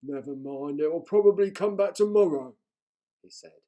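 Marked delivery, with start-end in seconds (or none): 0.0-2.6 louder, higher troll, shrugging it off; a cheerful troll consoling himself. A narrator's reading. Troll voice in read story.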